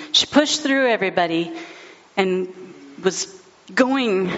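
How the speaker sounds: ordinary delivery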